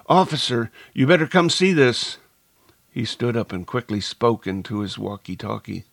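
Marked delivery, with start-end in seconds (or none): 0.1-2.2 raised, louder call to get attention; calling over. A narrator's reading. Narrator calls the campus policeman over to the body, calling out. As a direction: call out urgently to an officer. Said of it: "I called over".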